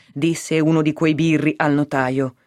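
usual speaking voice

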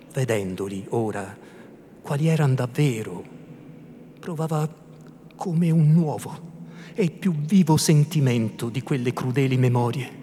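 usual speaking voice

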